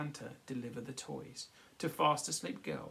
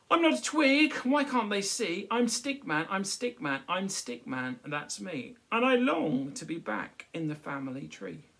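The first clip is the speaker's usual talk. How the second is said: high, loud, indignant stick voice; Stickman's protest; 6 semitones higher, 10 dB louder than usual. Acted. Stickman protesting to the crowd, high and insistent. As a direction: insist you're Stickman, not a poo stick.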